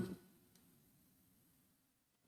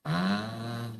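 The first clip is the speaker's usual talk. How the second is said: ordinary talk, nothing marked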